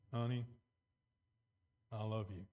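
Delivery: low, soft, unconvincing endearment; insincere. Shown as a demonstration. Husband to wife showing tone changes meaning, insincere. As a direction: say it as if you don't mean it, pitched low. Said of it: "the insincere tone the second time".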